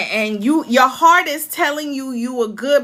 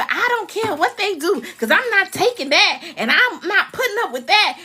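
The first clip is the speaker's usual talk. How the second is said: higher-pitched mock defiance; defiant; 7 semitones higher than usual. Counsellor mimicking people who won't take it, defiant. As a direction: imitate someone refusing to back down.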